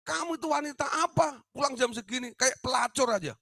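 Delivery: high, loud furious scolding; angry scolding. Retold or imitated. Mother scolding her daughter at the door, high furious rebuke. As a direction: lash out at a daughter coming home late. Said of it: "saya marah sama dia".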